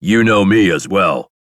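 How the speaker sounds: loud synthetic robot voice; robotic voice. A narrator's reading. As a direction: speak up like a machine. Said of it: "I say in a robotic voice".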